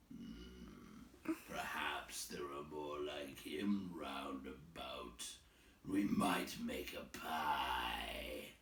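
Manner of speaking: very low, soft, slow troll rumble; scheming troll. Acted. Bert suggesting a pie, low slow troll rumble. Bert's voice.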